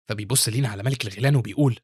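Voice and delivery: plain reading voice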